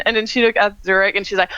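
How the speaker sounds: usual speaking voice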